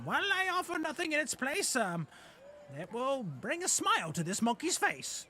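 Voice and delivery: small goblin making a high-voiced pitch; ladybug goblin. Goblin promising the monkey's thanks, high character voice. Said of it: "goblin dressed as a ladybug".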